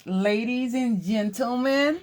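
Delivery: high showman call to the viewers; excited announcing. Real, not acted. Vlogger calling out to viewers, excited. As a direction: announce it like a host.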